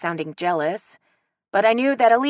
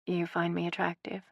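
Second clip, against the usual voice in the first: "You find me attractive?" asked much softer, hushed; lowered voice; 10 dB quieter than usual. A narrator's reading. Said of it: "She lowered her voice".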